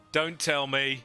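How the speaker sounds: usual speaking voice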